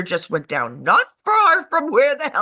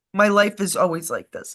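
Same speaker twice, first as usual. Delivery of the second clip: ordinary talk, nothing marked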